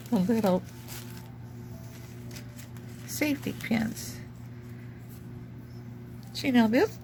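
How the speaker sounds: talking through hiccups; hiccuping between words. Real, not acted. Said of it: "got the hiccups".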